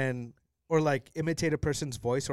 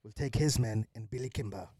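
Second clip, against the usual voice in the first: put-on low-key Birmingham accent; measured Brummie gangster; Thomas Shelby; 3 semitones lower than usual. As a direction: give orders in mock Birmingham, with pauses. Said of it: "trying to channel".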